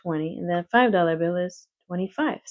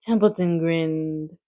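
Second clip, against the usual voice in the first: ordinary delivery, nothing marked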